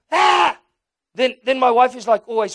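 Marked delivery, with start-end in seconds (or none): 0.1-0.6 loud mock baby yell; a toddler's sudden loud cry. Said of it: "then he goes".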